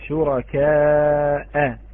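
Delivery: شركاء with the long final hamza vowel stretched very slowly; slow recitation form. Shown as a demonstration. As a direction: draw out شركاء, ending on the hamza. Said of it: "الكلمة هي".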